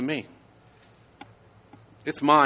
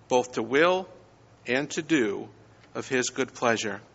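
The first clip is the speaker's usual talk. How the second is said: scripture reading; 3 semitones lower, 4 dB quieter than usual. Acted. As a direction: read the verse with reverent weight. Pastor reads scripture passage aloud.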